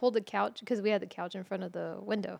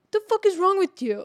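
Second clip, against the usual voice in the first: loud, higher retold yell at his sister; brother yelling; 8 semitones higher, 9 dB louder than usual. Brother yells at her for flinging herself over the couch, loud mock yell. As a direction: bark it like a startled big brother. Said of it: "he goes".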